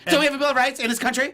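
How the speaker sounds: put-on high, quick drunk-girl insistence; drunk party girl. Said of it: "as a drunk girl from a party".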